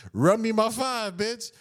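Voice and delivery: high, slow, demanding. Retold or imitated. Demanding his change back from the bartender. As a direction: insist on your five bucks.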